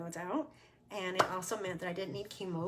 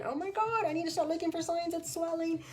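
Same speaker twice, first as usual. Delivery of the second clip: mock freaking out; 8 semitones higher than usual. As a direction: panicky inner voice, much higher. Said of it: "I'm freaking out thinking".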